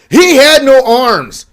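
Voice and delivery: high, loud, drawn-out yell; slow incredulous shout; exasperated outburst. Real, not acted. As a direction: stretch each word in loud disbelief.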